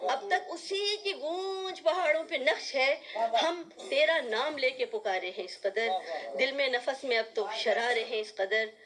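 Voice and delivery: lifted, ringing mushaira delivery that settles at the end; poetry recitation. Acted. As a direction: declaim the couplet with swelling emphasis. Recites ghazal couplets.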